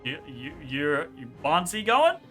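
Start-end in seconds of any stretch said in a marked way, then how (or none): none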